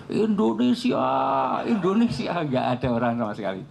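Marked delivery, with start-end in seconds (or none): 0.1-2.3 high calling shout, fading on the repeat; retold shouting for people. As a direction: call out across the empty mountain. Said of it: "saya teriak-teriak".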